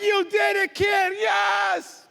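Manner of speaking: high, loud victory shout; cheering grandpa. Acted. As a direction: cheer the kid on.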